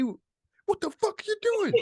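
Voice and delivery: very high, slow mock scolding; imitated angry cabbie. Cabbie challenging stranded travellers, high drawn-out mock outrage. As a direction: stretch it out in a squeaky-high cabbie impression. Retold cabbie confronting them again.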